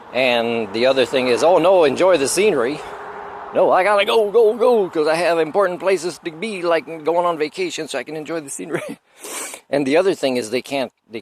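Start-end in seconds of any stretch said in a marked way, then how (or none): 1.4-9.0 high, loud sarcastic whine of a hurried driver; mock impatient driver. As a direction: ham up someone who must rush.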